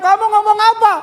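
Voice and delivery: high, loud mock yell from upstairs; mother-in-law yelling. Mother-in-law calls down at her son-in-law, high loud mock yell. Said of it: "Mamanya bilang".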